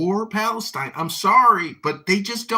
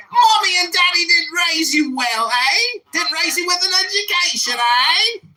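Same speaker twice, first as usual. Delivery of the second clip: shouting, higher, in a put-on British accent; 5 semitones higher, 4 dB louder than usual. Troll ranting at a Clubhouse caller, shouting in a put-on British accent. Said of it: "I guess I can stop talking like this now".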